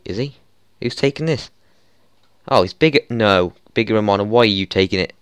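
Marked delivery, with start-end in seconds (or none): none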